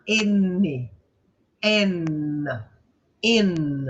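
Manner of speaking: "N, N, in" with each N held; drawn-out "N" and "in", never an M; open-mouthed N. Shown as a demonstration. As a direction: sustain N without closing the lips. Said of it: "e não fecha a boca".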